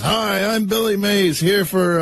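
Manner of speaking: high, drawn-out pitchman yell; Billy Mays. Acted. Showing the host the costume act, imitated pitchman yell. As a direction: imitate a yelling TV pitchman, held a little in check. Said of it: "that guy that yells".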